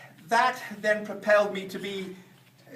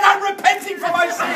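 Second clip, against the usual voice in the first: woman's voice; 11 semitones higher, 8 dB louder than usual. Retold or imitated. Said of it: "the woman said".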